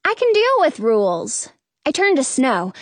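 usual speaking voice

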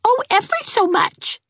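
put-on high, keen little girl; a child eager to agree; high, eager girl's voice. A narrator's reading. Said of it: "said Geraldine".

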